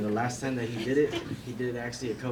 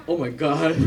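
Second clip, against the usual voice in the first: mock shock; 5 semitones higher, 8 dB louder than usual. Imitating him after letting it rip.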